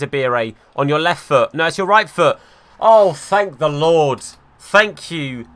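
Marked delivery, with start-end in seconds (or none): 2.8-5.5 loud, slow cry of relief; relieved cheer. Real, not acted. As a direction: let the relief pour out, stretched long. Eze finally scores.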